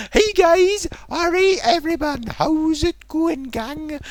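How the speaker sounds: high-pitched mock-cheery hello; chirpy video greeting. Imitating streamer greeting viewers on videos, over-jolly. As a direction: greet the fans in a silly high voice. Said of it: "on his other videos, he's like".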